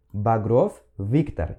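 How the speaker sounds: very slow, careful name; slow demo. Shown as a demonstration. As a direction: stretch out Багров. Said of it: "essa letra V". (pronúncia lenta de Багров)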